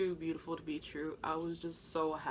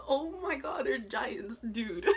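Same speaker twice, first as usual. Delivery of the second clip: higher, laughing through the words; laughing amazement; 6 semitones higher, much slower than usual. Retold or imitated. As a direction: giggle it out, amazed. Laughing about how tall they are.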